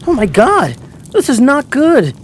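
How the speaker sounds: loud, high exclamation of dismay; alarmed. Acted. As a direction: cry out at a disaster unfolding. Teacher sees curtains ablaze.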